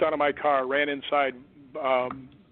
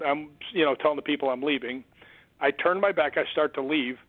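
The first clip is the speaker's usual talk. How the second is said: usual speaking voice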